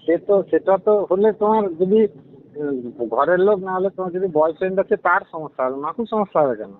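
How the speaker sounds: normal talking voice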